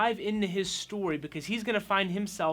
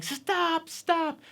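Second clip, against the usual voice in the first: high-pitched mock yell; retold yelling; 12 semitones higher, 4 dB louder than usual. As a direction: imitate a neighbor yelling from across the yard. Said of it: "just yelling".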